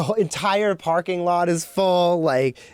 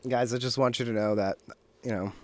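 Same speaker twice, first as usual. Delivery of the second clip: recovered voice; 7 semitones lower, 6 dB quieter than usual. Pretend. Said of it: "brought my voice back to normal".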